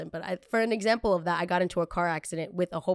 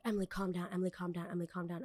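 much softer, repeated hushed plea; hushed pleading; 10 dB quieter, faster than usual. Retold or imitated. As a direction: quietly beg her to calm down. Sister quietly calming her.

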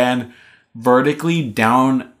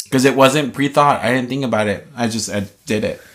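normal talking voice

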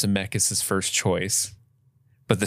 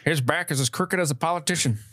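quoting the doctor; 6 semitones higher than usual. Retold or imitated. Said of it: "I could have swore he said".

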